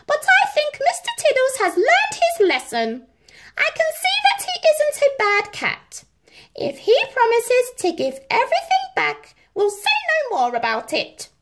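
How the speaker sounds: high, gracious royal voice; forgiving Queen. A narrator's reading. Queen forgiving Mister Tiddles, gracious. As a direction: grant the pardon graciously.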